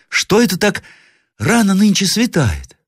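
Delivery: put-on very high child's voice; young boy. A narrator's reading. Grandson asks grandmother about howling outside, piping child voice. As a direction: pipe up like a curious little boy. Boy wonders at early light.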